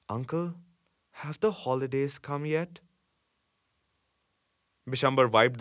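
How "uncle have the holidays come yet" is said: soft, higher, absent voice; vacant. A narrator's reading. Delirious boy asks his uncle about holidays, vacant. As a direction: ask it faintly, as if delirious. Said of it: "said vacantly".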